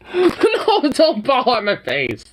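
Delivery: high, loud, alarmed cry; startled cry. Real, not acted. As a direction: yelp in surprise. Dog falls on him.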